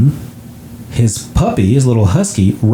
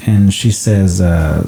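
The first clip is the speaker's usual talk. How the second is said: ordinary talk, nothing marked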